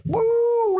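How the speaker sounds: high-pitched drawn-out "woo"; playful whoop. Pretend. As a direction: mimic a trick with a silly high whoop. Imitates tricks with the toy board.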